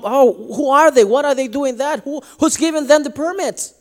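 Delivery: high, indignant mock questioning.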